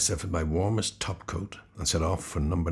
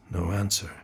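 usual speaking voice

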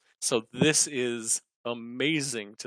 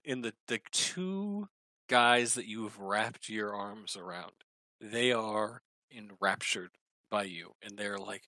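ordinary talk, nothing marked

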